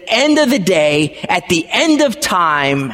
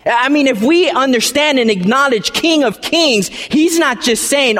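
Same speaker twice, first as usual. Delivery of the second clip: ordinary delivery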